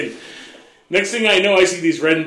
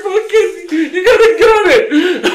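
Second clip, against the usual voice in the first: high, slow laughing instruction; 13 semitones higher, 6 dB louder, slower than usual. Retold or imitated. Husband coaching his wife to gun it, high laughing. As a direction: squeal it through laughter. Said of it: "Telling her".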